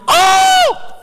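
very high, loud astonished cry; surprised shout. Retold or imitated. Photographer spotting the accidental photo, shouting in surprise. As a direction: blurt it as the shock hits you. Sees the shot he took.

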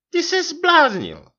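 high, loud, stretched-out wifely cry; manželčin výkřik. A narrator's reading. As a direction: exclaim it as the outraged wife. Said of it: "vykřikla". (pohoršený výkřik vysokým hlasem)

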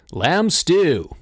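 hearty, pleased exclamation; happy cry. A narrator's reading. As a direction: relish the dish happily. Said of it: "he cried happily".

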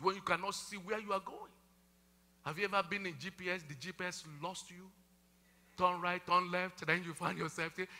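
usual speaking voice

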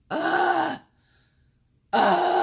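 loud, high exasperated ugh; disgusted groan. Retold or imitated. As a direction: groan like the news disgusts you. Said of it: "I just am like".

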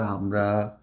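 very slow, low and drawn-out, as if writing; writing aloud. Shown as a demonstration. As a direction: say "আমরা বাংলাদেশকে" stretched out word by word. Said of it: "আমি এটাই লিখি".

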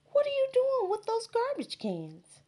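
twins' voice. Acted. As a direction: sing out like little sisters. Twin sisters ask Max.

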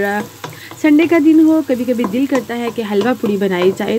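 ordinary delivery

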